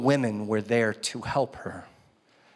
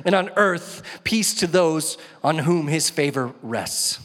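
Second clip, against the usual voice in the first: raised, emphatic Bible reading for a congregation; proclaiming scripture; 6 semitones higher, 4 dB louder than usual. Acted. Preacher reads the angel's good news to the congregation, proclaiming. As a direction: read it out as a rousing announcement.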